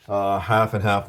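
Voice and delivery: ordinary talk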